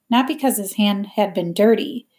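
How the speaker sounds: usual speaking voice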